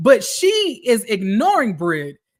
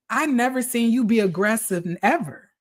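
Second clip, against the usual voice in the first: normal talking voice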